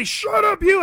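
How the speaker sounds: high, loud mock shout. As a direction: bark it like an angry heckler. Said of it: "And they'd say".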